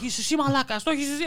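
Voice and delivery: imitated yelling. Customer fighting with shop staff, high imitated yelling. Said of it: "and they're yelling".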